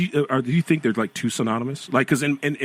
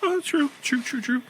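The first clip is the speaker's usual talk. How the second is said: very high squeaky agreement; squeaky mock agreement; 14 semitones higher, 4 dB quieter than usual. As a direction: chirp it in falsetto.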